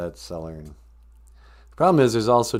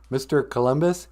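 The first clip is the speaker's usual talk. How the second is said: usual speaking voice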